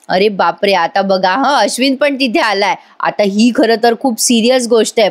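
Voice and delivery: ordinary talk, nothing marked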